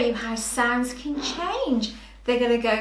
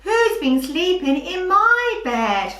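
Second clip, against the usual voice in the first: middle-pitched, raised sing-song bear; put-on voice, moderately higher than usual; storybook bear voice; 6 semitones higher than usual. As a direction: act out the middle bear's question. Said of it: "not only people's voices that make high and low sounds".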